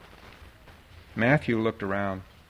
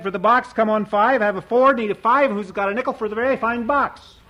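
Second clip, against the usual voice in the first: much higher, louder auctioneer cry; auctioneer's call; 9 semitones higher, 5 dB louder than usual. A narrator's reading. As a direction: sing out the bid like an auctioneer. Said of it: "his auctioneer's talk".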